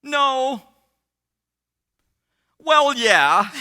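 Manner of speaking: high, loud mock-female voice; acted young woman. Fiancée answering the counselor, high and loud imitation. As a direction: imitate her in a high, drawn-out wail.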